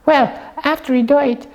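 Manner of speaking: normal talking voice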